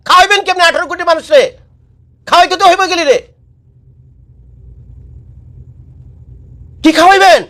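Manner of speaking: exasperated outcry. Real, not acted. Vlogger challenging politicians, high exasperated outcry. Ranting at politicians about feeding people.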